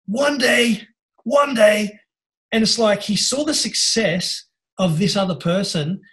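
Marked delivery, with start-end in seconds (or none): 0.1-2.0 high, drawn-out declaration of hope; pitched up, wishful and emphatic; inspired dreamer. Retold or imitated. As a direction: imitate an awestruck onlooker vowing it. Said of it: "just was inspired by".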